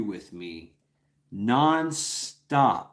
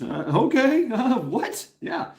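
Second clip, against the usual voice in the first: mock surprise; 10 semitones higher than usual. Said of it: "you're like".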